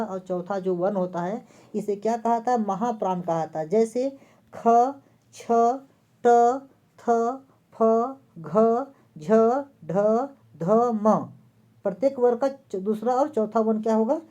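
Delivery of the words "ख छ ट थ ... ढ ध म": each letter spaced out slowly; slow letter recitation. Shown as a demonstration. Teacher listing aspirated letters for students, slowly. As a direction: set every letter apart, slowly. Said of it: "reciting letters one by one".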